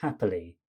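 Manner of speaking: "Happily" low and even, every syllable light; no syllable stressed. Shown as a demonstration. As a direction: keep all of "Happily" unstressed and down in pitch. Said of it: "we start with no sound stressed".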